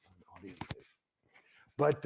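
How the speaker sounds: ordinary talk, nothing marked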